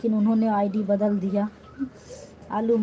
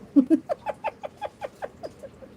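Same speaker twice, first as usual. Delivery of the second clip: high giggle. Real, not acted. Laughing after teasing viewer.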